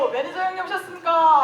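high, hammed-up welcome; playful exaggerated salute; mock-deferential greeting. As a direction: greet a friend like a grand boss. Mock-formal greeting of arriving friend.

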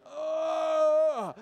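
high, loud, drawn-out mock wail; long anguished "oh" of dismay; exasperated cry. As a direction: cry out in comic despair. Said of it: "and you're like".

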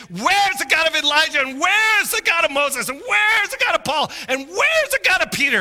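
high, loud pleading shout; raising his voice. Retold or imitated. Preacher reenacting crying out to God, shouting. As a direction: call out each name to heaven. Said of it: "I'm just raising my voice and saying".

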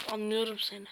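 ordinary talk

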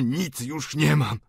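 slow, drawn-out lament; despairing refrain. A narrator's reading. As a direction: stretch the words in helpless despair. Despairing refrain of the old father.

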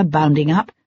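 plain reading voice

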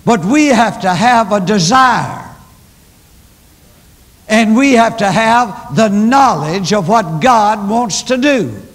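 a rising pulpit call; preaching. Acted. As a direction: preach it out with full force. Preaching at full force.

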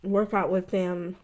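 eating chicken between words; talking while chewing. Real, not acted. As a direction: mouth partly full of food. Seen 4: Gloved hands hold wing at mouth, jaw chewing while talking throughout.

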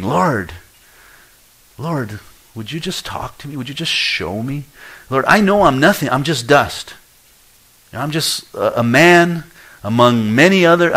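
high, loud, humble pleading to God; pleading prayer. Pretend. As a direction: beg the Lord as mere dust. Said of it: "You ought to be pleading".

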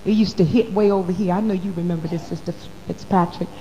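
ordinary talk

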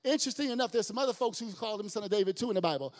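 ordinary talk, nothing marked

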